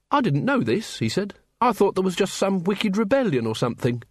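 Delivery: lightly voiced eager young man, surprised; Carrot's voice. A narrator's reading.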